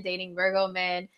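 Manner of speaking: ordinary talk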